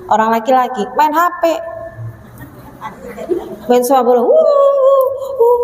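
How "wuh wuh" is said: high mock whoops; cheering football fan. Father watching football while the child cries, cheering. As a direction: whoop like a man engrossed in football.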